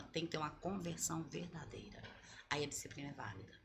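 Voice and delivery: hushed, breathy whisper to close the point. Real, not acted. Pastora whispering her point to the live audience. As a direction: lean in and whisper the conclusion. Preacher drops to intimate point.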